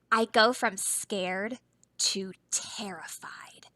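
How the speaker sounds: slow unvoiced frightened whisper; scared whisper. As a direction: whisper it shaking with dread. Gasp then whisper.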